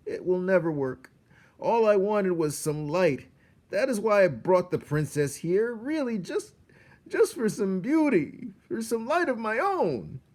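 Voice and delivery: high, loud lament; despairing rat. A narrator's reading. Roscaro pours out his longing before Despero. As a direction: plead out your broken hope.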